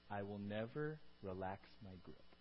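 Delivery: low, soft, slow assurance; steady them with a hushed promise; quiet, deep calm with long pauses; reassuring promise. Acted. Voices God's calming promise.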